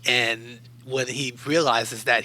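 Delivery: usual speaking voice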